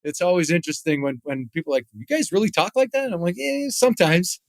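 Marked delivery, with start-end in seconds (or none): 1.9-3.1 higher-pitched, curious disbelief; imitated incredulous question. Fans quizzing hockey players, mock-incredulous. 3.4-4.4 high, noncommittal admission; retold hedging answer. Player answering curious fans, high and hedging. Voices his sheepish answer to those people.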